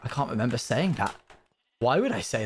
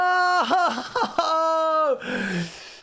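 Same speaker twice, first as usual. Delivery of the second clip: high laugh at the disaster; helpless laughter; 4 dB louder than usual. Real, not acted. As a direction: burst out laughing at the mess. After the disaster.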